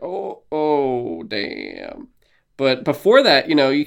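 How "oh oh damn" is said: sinking reaction, softly stretched out; stunned realization. Acted. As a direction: let it sink in slowly. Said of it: "and you're like".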